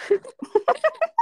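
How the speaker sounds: high squealing laugh; loud, high burst of laughter. Real, not acted. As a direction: burst into high-pitched giggles. Both break up over sign-off.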